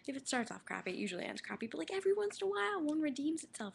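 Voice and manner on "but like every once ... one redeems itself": excited. Real, not acted. Excited burst about rare good book.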